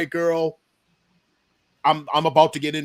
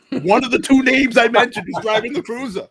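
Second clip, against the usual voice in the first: punchline reveal; 7 semitones higher, 7 dB louder than usual. Real, not acted.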